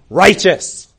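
loud, sudden courtroom cry; calling out. Acted. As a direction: call out loudly to interrupt the court. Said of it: "another voice calls out".

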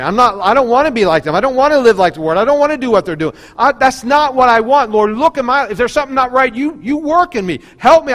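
loud, high, urgent cry to God; preacher crying out in fervent prayer; impassioned plea. Acted. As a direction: plead with the Lord at full force. Acted-out fervent plea to the Lord.

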